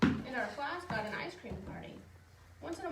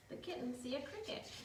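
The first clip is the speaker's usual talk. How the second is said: plain reading voice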